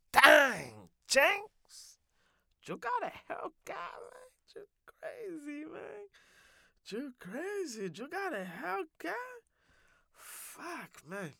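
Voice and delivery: high-pitched, mock-amazed admirer; awed admiration. Admirer seeing the Hellcat at Dunkin' Donuts, high-pitched awe.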